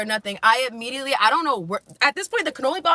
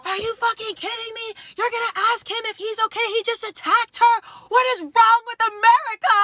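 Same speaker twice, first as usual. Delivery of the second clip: high-pitched outraged outburst, building louder; friend flipping out; 12 semitones higher, 3 dB louder than usual. Retold or imitated. Friend confronting the man who asked if attacker's okay, flipping out. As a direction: mimic an incredulous friend losing it, voice way up. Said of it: "My friend immediately just flipped".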